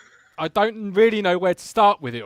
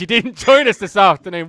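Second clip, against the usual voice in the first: laughing; 7 dB louder than usual. Real, not acted. Host laughs greeting late guest.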